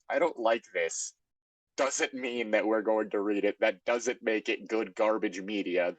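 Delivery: ordinary talk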